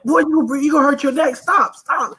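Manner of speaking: high-pitched, urgent parental warning; worried father's yelp, acted out; alarmed dad. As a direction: retell a panicked dad trying to stop his kid. Father retells warning his flipping son.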